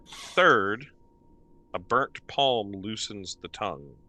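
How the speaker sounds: slow, solemn reading; inscription read. Acted. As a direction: read it like an ancient riddle. DM reads the inscription.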